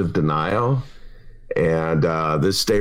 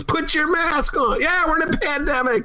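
nagging wife voice. Retold or imitated. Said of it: "My wife was".

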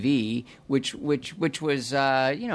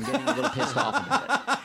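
high, laughing mock grumble; 13 semitones higher than usual. Real, not acted. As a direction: laugh through the complaint. Joking complaint, laughing.

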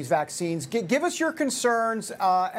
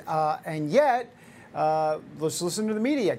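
ordinary talk, nothing marked

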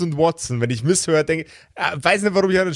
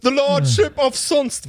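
put-on English accent; 11 semitones higher than usual. Mock grand English title.

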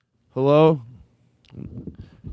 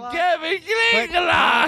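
mock-exasperated correction; 14 semitones higher than usual. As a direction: screech the made-up name in mock outrage. Mock-exasperated correction of fake name.

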